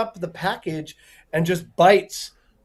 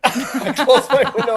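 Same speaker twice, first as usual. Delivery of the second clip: laughing; 7 semitones higher, 5 dB louder than usual. Real, not acted. Laughing while retelling.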